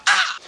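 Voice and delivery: very high mock yelp; sudden pain cry. Showing pain coming out of nowhere, sudden mock cry. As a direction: shriek briefly as if stabbed by pain. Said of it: "the pain comes out of nowhere and you're just like".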